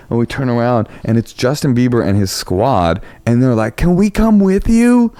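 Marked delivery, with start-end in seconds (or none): 3.8-5.1 high, drawn-out plea; pitched-up, stretched mock pleading; retold eager request. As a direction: imitate fans asking to tag along.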